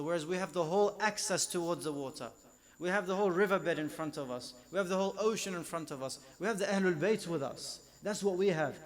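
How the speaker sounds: loud, rising pulpit proclamation; fervent preaching. Acted. Preacher to his congregation, loud and fervent. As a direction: preach it out to the whole hall.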